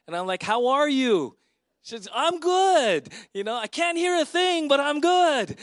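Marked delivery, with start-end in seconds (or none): 0.4-1.4 loud, high call to someone hard of hearing; acted shout right into her ear; shouting to be heard. 2.1-3.1 loud, very high imitated holler; cheerfully booming reply from someone hard of hearing; Thelma. 3.3-5.6 loud, very high imitated holler; cheerfully booming reply from someone hard of hearing; Thelma.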